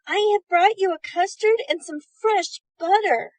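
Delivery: put-on high, loud girl's voice; sweet girl calling through the door; little girl voice. A narrator's reading. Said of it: "she cried aloud".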